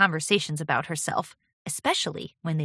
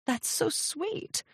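high, soft, slow and touched; a little choked up; 9 semitones higher, 7 dB quieter, much slower than usual. A narrator's reading. Mentor touched by apprentice's praise, choked up. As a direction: squeeze it out softly, deeply moved. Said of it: "starting to get a little choked up".

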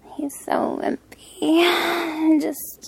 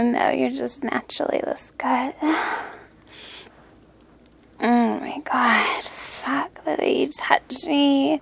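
whispering; 4 dB quieter than usual. Acted. Whispered praise.

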